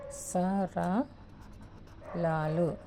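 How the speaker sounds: సరళాలు stretched out very slowly; slow dictation. Shown as a demonstration. Teacher dictating a term to students, very slowly. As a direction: draw out సరళాలు syllable by syllable. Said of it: "ఇవి సరళాలు".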